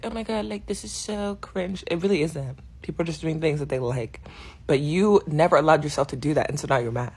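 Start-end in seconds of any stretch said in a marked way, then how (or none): 0.0-1.8 mocking cringe critic. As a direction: drag it out like an eye-rolling hater. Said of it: "everybody's always like".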